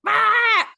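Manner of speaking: a sudden startled scream; mock scream. Retelling the twin's ambush to friends, a mock scream. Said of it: "goes in and goes".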